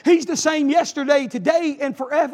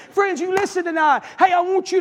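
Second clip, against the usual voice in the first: shouted preaching; 4 semitones higher than usual. Real, not acted. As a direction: call out to the church.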